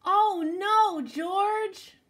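loud, high cry of dismay; exasperated cry. Acted. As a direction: call out the dog's name in mock despair. Exasperated cry at dog's mischief.

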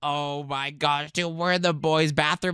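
usual speaking voice

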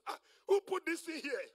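loud, high exclamation; indignant protest. Acted. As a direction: act out startled complaint at being tied up. Lazarus protests his grave clothes.

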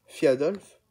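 plain reading voice

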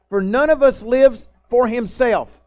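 slow, loud preaching, every word weighted; preaching scripture. Acted. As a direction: proclaim it slowly to the church. Preacher reading Romans aloud.

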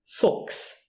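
Fuchs said as "fooks"; K sound. Shown as a demonstration. Teacher showing learners CH before S, Fuchs with KS. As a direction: chs in Fuchs pronounced KS. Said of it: "you pronounce it K".

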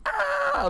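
a sudden shrill-free, very high startled scream. Retold or imitated. Retelling his horror-video scare, very high startled scream. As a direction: yelp it out high and short. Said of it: "when I was like".

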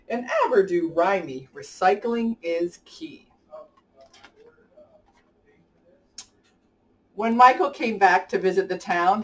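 lively rhyming storybook read-aloud; reading to children. A narrator's reading. Host reading a rhyming picture book to kids, lively storybook voice. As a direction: bounce the rhymes along for young listeners.

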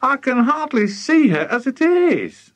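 high, loud, desperate wail; frantic character lament. A narrator's reading. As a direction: wail it out in high-pitched distress. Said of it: "Missus Cranky wailed".

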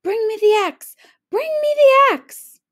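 high, loud frantic yell; urgent shout. A narrator's reading. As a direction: scream for the axe. Urgent call for axe.

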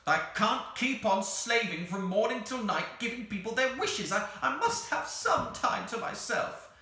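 high-pitched, loud creature tirade; exasperated creature. Acted. The Psammead complains of slaving at wishes, exasperated. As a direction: wail crossly like an overworked little creature.

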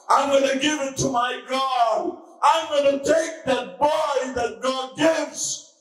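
high, rising promise shouted to God; preacher voicing the woman's vow to God; fervent vow. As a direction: swear the vow with mounting fervour. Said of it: "I'm crying".

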